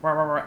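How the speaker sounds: mock barking noises; imitated dog bark. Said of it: "shows up and is like".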